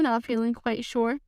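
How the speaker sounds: ordinary delivery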